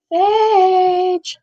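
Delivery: high, drawn-out name call. Real, not acted. Calling the pet who walks past.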